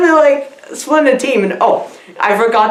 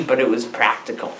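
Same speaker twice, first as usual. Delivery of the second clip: normal talking voice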